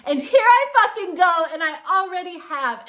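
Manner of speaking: loud, high, fired-up declaration; a woman loudly claiming what she wants; defiant cry. Retold or imitated. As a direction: shout it like you just won. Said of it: "the woman that says".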